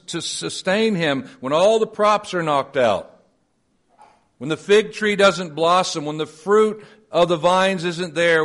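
high, loud pulpit preaching; rising sermon fervor; preaching at full force. Acted. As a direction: drive each clause home to the congregation.

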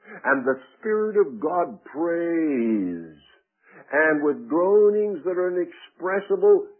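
slow, stretched pulpit proclamation; forceful preaching. Acted. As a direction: draw out the key words and lift them strongly. Preaches emphatically.

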